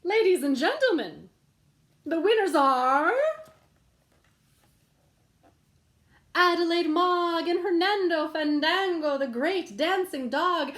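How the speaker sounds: loud, fast game-show announcer. A narrator's reading. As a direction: build it up like a TV host revealing winners. Host announces the winners.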